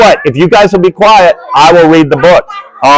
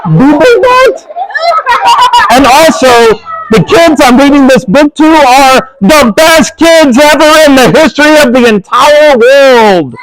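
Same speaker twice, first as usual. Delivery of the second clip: hammed-up flustered reader; 10 semitones higher than usual. Reader to giggling kids, hammed-up mock panic. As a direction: ham up being tricked by the book.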